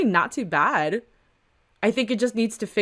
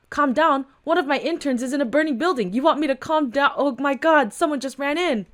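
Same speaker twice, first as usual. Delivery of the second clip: higher, heated disbelief; indignant outburst; 6 semitones higher than usual. Acted. Woman furious her intern is in a burning building. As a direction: throw his words back at him. Frantic woman argues with officer.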